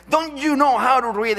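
loud, high, angry homeowner yelling; a Spanish-accented homeowner snapping at the preacher, mock angry. Homeowner yells at soul winner, loud and angry. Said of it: "pointed the sign and said".

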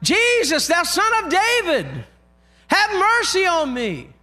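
high, slowing plea called out; crying out. Retold or imitated. Blind man calling to Jesus amid the crowd, crying out. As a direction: wail it out high to be heard. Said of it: "this man crying out".

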